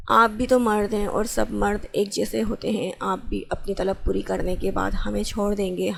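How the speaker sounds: usual speaking voice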